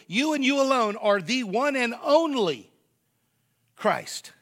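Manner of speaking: preacher's emphatic climax; emphatic build. Shown as a demonstration. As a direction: drive it home word by word.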